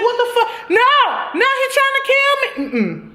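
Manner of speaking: hammed-up terrified squeal; mock panic. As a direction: shriek it like he's coming for you. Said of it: "I would be like".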